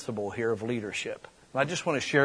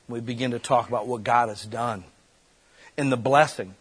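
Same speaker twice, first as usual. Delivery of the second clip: ordinary talk, nothing marked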